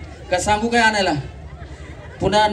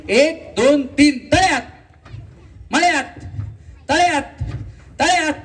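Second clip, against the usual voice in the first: game host's high call; calling game commands; 6 semitones higher than usual. Real, not acted. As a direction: count in, then high spaced calls. Host calls out game commands.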